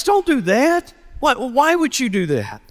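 high-pitched urging, acting the passerby; acted alarmed pleading. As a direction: plead with him, voice jumping up. Stranger pleads with a would-be jumper.

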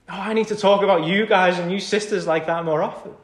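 usual speaking voice

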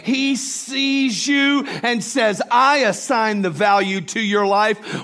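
slow, high, loud proclamation; full-force preaching. Real, not acted. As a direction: proclaim it with rising force to the congregation.

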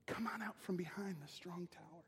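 soft, hushed coaxing; serpent's whisper of a lie; whispered temptation. Acted. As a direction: lure them out in a whisper.

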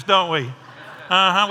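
normal talking voice

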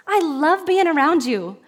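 high, drawn-out mock cheer; cheerleader-style encouragement. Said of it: "what if we were like".

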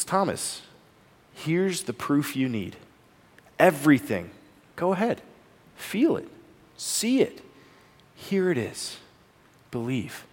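Jesus gently offering proof to doubting Thomas; gentle kindness. Acted. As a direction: invite him close without blame. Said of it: "met with kindness and care".

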